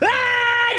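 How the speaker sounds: high, loud startled scream; quick high yelp of fright; short scream. Acted. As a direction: let out a sudden shriek. Scream as teleported.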